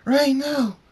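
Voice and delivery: high, loud horrified yell; desperate full-voiced cry; screaming. A narrator's reading. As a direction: scream out of a nightmare. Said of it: "He screams".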